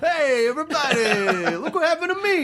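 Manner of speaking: hammed-up partier calling to the whole room; Jim Belushi character. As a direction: shout it high like a party animal. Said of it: "like the Jim Belushi character in".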